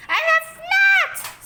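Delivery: high, loud, slow denial scream. A narrator's reading. Boy screaming denial at his mother. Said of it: "screamed Henry".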